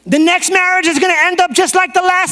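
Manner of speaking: high, loud, drawn jeer; taunting yell. Acted. The enemy shouting discouragement at a believer, yelling. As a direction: yell it from far off, pitched high. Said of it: "And so he yells back here".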